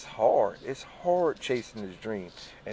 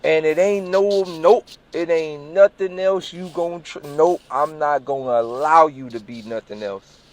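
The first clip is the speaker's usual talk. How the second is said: higher, louder insistence; stern self-command; 5 semitones higher, 8 dB louder than usual. Retold or imitated. Telling himself to be an artist, loud stern insistence. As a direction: order yourself not to quit. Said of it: "I told myself".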